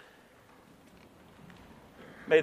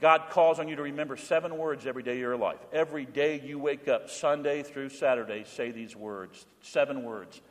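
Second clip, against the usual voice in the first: ordinary talk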